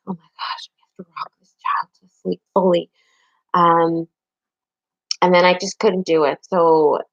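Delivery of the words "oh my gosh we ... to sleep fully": hushed, breathless whisper of dread; whispered panic. Retold or imitated. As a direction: whisper it like you can't bear to say it. Retelling own panicked thought.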